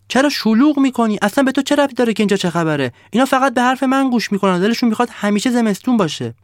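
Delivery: high, loud and fast snowman snapping back; snowman yelling. Acted. The snowman yelling at the sun to mind its business, high and fast. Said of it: "داد زد".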